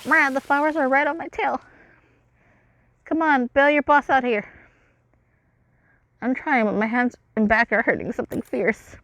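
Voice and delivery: high, loud frantic voice; character voice. Acted. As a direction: shout it while being chased. Voices game dialogue.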